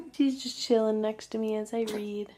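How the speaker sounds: usual speaking voice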